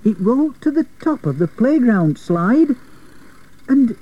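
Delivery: high, suspenseful narration with pauses; hushed wonder. A narrator's reading. Narrator tells of the wheel vanishing skywards, in wonder. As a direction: let the voice climb in wonder, pausing before the surprise.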